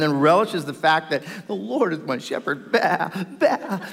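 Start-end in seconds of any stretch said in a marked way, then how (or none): 2.7-3.8 laughing little sheep bleats; playful baa with a laugh; mock sheep bleat. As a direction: bleat like a contented lamb, chuckling. Mocking sheep in the Psalm.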